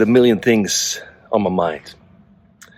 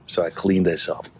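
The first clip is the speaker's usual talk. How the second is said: normal talking voice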